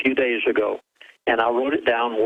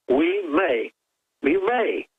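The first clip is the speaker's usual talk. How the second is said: "may" said higher and pushed hard, both times; stress on may; 5 semitones higher, much slower than usual. Real, not acted. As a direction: lean on "may" as a warning. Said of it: "watch out whenever they say may".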